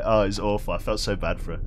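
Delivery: ordinary talk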